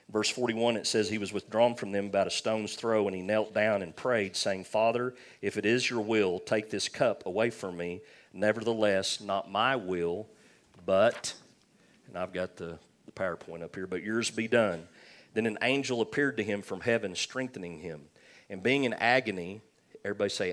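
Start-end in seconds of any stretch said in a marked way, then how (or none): 1.1-11.3 scripture reading. Acted. Reads scripture aloud. 13.9-19.6 scripture reading. Acted. Reads scripture aloud.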